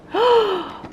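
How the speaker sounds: high, sharp gasp of surprise; playful pretend gasp; mock gasp. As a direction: gasp as if startled by the toy. Sees the toy behind calendar.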